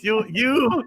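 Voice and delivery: ordinary delivery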